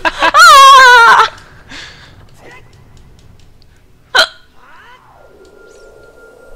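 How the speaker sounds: long high laugh; loud squealing laughter; burst of laughter. Real, not acted. Laughing at the game.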